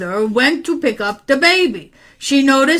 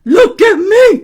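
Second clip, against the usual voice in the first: high, loud exclamation; frantic man; 9 semitones higher, 7 dB louder than usual. Acted. Man showing boy his trouble, high and frantic. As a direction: burst out in mock alarm. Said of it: "said the man".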